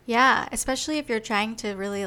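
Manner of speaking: usual speaking voice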